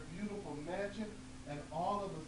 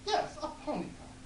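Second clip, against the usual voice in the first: put-on old woman; 10 semitones higher than usual. Old woman answers fish.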